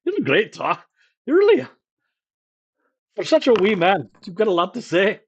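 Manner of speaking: put-on Scottish accent, brisk and teasing; gruff Scottish innkeeper teasing. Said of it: "I've been doing a Scottish accent".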